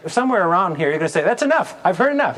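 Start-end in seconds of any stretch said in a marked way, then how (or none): 1.2-2.4 much higher, excited "That's enough" cutting the pitch short; eager customer. Pretend. Listener cuts off restaurant description, excited and much higher. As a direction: sold already, can't wait. Imagined diner convinced, wants restaurant.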